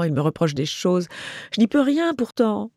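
pouting complaint, rising high at the end; petulant little protest; sulking. Acted. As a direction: sulk like one wrongly blamed. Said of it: "Elle bouda".

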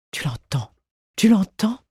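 urgent feverish whisper rising to eager cry; breathless, fevered excitement. A narrator's reading. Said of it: "disait-elle fiévreusement".

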